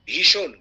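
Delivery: "ভীষণ" loud and crisp, every sound clear; crisp pronunciation. Shown as a demonstration. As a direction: punch out "ভীষণ" distinctly. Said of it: "উচ্চারণগুলো লক্ষ্য করুন".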